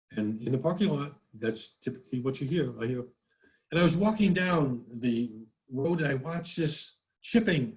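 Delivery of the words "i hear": normal talking voice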